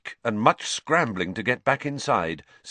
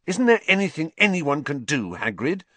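fierce; 7 semitones higher than usual. Acted. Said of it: "Harry asked fiercely".

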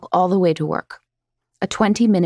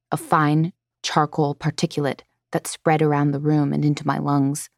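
usual speaking voice

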